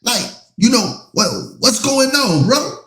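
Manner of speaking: high, pleading "for real, bro?"; rising disbelief at a friend; incredulous call-out to Shannon. Retold or imitated. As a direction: confront your friend in disbelief, voice climbing. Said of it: "I'm like".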